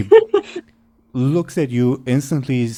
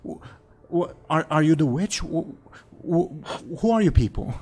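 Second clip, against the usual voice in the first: stuttering and higher, scared; frightened stammer; 8 semitones higher than usual. Acted. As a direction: stammer out of fear at strangers. Frightened woman questioning strangers.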